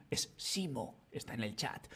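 hushed secret aside; whisper. Acted. Teacher's secret aside about Simo. (susurro confidencial)

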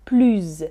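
"plus" said "plyz", low and falling. Shown as a demonstration. Teacher runs through the three forms. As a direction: end "plus" on a buzzing Z. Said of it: "Les trois prononciations".

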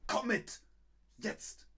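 high, sharp barked command; aggressive. Shown as a demonstration. Teacher shows how the bare imperative sounds, aggressive command. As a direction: order them along aggressively. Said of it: "Komm mit ist aggressiv".

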